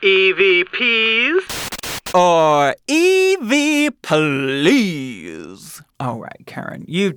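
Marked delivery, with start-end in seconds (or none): 0.1-5.8 slow spooky announcer voice. Pretend. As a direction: drag it out like a haunted host. Announcing ghost voice segment.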